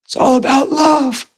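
high-pitched, choked-up retelling; imitating tearful bawling. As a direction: imitate a man overcome with tears. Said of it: "He's bawling".

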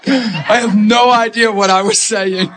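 high, laughing, drawn-out confession; laughing helplessly. Real, not acted. Preacher admitting he lost his place, real laughter. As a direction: lose it laughing at your own muddle. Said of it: "This is funny".

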